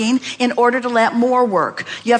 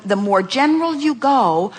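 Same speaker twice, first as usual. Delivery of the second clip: ordinary talk, nothing marked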